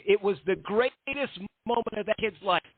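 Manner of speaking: high-pitched split-personality character voice, boasting; Electric Eddie. Acted. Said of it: "subtle Electric Eddie voice".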